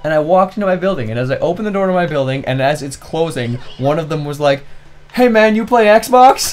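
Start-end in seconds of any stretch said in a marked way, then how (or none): 5.1-6.5 high, loud, slowed call; retold kid's shout, pitched up; imitated calling out. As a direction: call after someone in a raised voice. Voicing kid's sudden friendly call.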